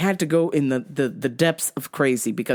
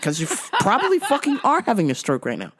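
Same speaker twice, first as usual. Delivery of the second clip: ordinary talk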